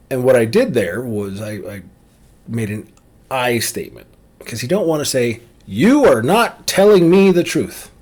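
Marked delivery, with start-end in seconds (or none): 5.6-7.9 louder pointed accusation, "You" hammered; accusing. Shown as a demonstration. Demo of an accusing line to avoid, sharp and loud. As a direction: jab the finger with "You". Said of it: "That's an accusation".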